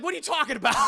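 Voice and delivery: loud, high incredulous question; laughing disbelief. Retold or imitated. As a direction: exclaim it, breaking into laughter. Teller mocks absurd plot twist.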